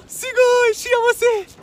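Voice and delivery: squealing with delight; high thrilled squeal; excited anime fan. Acted. Thrilled on Cheetah date.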